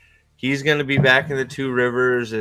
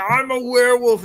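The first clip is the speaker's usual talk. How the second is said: high, loud strained cry; comic werewolf shout; mock werewolf; 11 semitones higher, 5 dB louder than usual. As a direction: announce it in a hammy monster yell. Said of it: "He's gonna be like".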